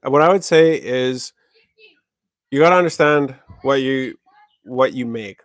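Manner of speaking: usual speaking voice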